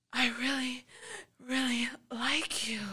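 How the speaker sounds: barely able to speak from arousal; breathless whispered confession mid-sex; breathy whisper. Retold or imitated. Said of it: "he was just so breathy".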